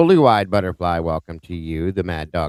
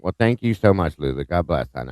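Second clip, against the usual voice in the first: normal talking voice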